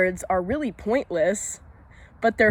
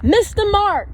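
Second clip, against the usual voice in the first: loud, much higher-pitched cry; high-pitched mock outburst; sudden shout; 12 semitones higher, 5 dB louder, slower than usual. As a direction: yell it out high, as a comic outburst. Shouts the repeat of own words.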